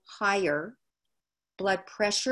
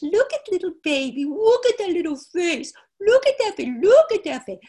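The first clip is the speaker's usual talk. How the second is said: put-on much higher and louder, sing-song baby talk; 11 semitones higher, 8 dB louder than usual. A woman cooing over a baby, high and loud. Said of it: "Women immediately go into the high pitch".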